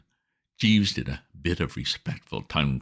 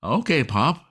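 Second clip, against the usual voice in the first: put-on child's voice; 8 semitones higher than usual. A narrator's reading. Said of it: "the child... said".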